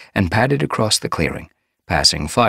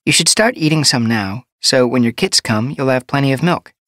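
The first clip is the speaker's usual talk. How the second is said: higher, kindly healer voice; gentle medicine cat; 10 semitones higher, 5 dB louder than usual. A narrator's reading. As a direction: advise a pregnant queen kindly.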